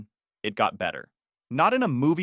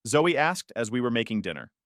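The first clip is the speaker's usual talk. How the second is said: plain reading voice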